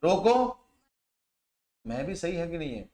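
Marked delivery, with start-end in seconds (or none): 0.0-0.6 "रोको" high, rising, cut off on its own; higher-pitched "रोको" prompting the rest; stressed stand-alone command. Shown as a demonstration. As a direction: punch रोको as a complete order. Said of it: "मैंने क्या बोला था".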